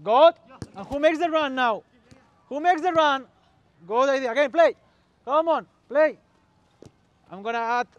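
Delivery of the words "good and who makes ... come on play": raised, punchy calls to the players; loud coaching calls. Real, not acted. Coach asking who makes the run, calling out loudly. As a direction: urge them on loudly.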